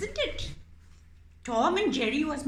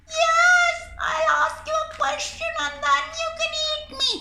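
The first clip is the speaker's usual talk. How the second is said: much higher, loud squeaky begging; squeaky pleading mouse; 7 dB louder, faster than usual. Acted. Mouse puppet begging the cat not to eat it, squeaky. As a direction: squeak for your life.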